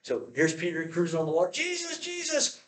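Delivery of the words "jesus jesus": panicked cry for help. Retold or imitated. Peter sinking, calling for help.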